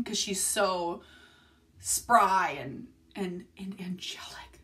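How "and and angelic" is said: soft fond whisper. Real, not acted. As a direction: whisper it tenderly.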